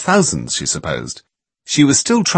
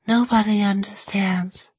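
mournful whisper; 12 semitones higher, slower than usual. A narrator's reading. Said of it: "staring mournfully at the wall".

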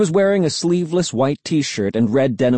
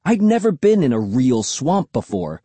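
usual speaking voice

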